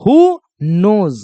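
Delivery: "Who knows" drawn out word by word; slow, separated words. Shown as a demonstration. Teacher repeating "Who knows" for pupils, slow and word by word. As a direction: give "Who" and "knows" each its own slow beat.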